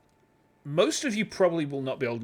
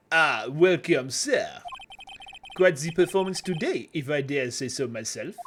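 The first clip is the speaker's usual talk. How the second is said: a hint of a French hotel clerk's accent. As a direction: welcome him with light French flair. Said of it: "he's supposed to be French".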